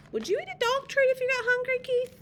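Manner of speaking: high-pitched, quick playful question. Real, not acted. Storyteller asks Keith about eating dog treats, high quick voice. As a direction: ask it fast, up high. Reader turns question on listener.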